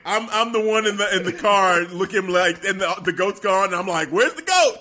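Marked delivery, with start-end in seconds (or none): 4.1-4.8 laughing mock panic. Acts his Jurassic Park reaction.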